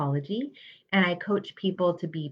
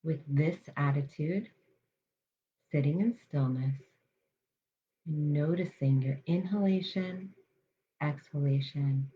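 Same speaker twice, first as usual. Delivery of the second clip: guided meditation. Acted. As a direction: ease listeners into calm, unhurried.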